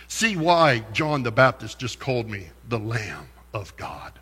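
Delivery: normal talking voice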